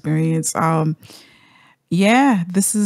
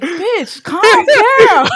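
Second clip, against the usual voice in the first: much higher, louder teasing calm down; mock friend scolding; 13 semitones higher, 6 dB louder, much slower than usual. Derby telling Patty to calm down, high and teasing. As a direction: tease her to chill out. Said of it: "Derby was like".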